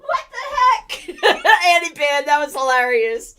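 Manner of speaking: laughing. Real, not acted. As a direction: gasp it out while laughing. Laughing hard.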